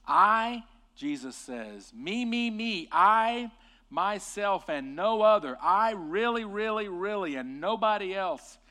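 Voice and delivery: high and loud, hammering each me and really; preacher voicing Jesus's emphatic I am. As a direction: proclaim I myself and no other with full force. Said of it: "That's what it says in the original text".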